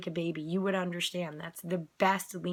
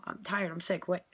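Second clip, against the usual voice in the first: ordinary delivery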